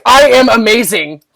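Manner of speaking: loud, laughing mock self-praise; mock mirror affirmation. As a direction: ham up a cheesy mirror pep talk. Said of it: "stand in front of your mirror and be like".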